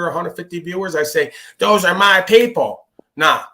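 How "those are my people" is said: slow, louder proud claim; slow, swelling boast of ownership. Retold or imitated. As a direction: puff up and claim them as yours. Quoting his own ego.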